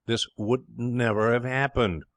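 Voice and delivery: slow, severe reproach. Acted. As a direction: deliver the blame slowly and severely. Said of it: "severe to Pa Tuxton".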